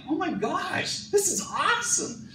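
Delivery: high, gushing delight, dragged out on awesome; thrilled gush. Retold or imitated. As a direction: gush like the trip was the best ever. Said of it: "you're like".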